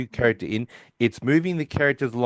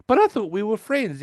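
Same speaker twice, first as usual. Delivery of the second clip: much higher hurt whine; whiny Sheldon; 10 semitones higher than usual. Retold or imitated. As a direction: pretend to be wounded.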